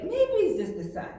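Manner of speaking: high, loud, bewildered inner voice; puzzled. Retold or imitated. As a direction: wonder aloud, baffled. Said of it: "I was like".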